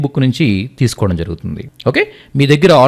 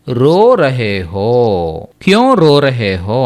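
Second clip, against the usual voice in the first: very drawn-out syllables, lower pitch; teacher's extremely slow pronunciation demo; slow phrase modelling; 4 semitones lower, much slower than usual. Shown as a demonstration. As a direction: stretch every word out for learners.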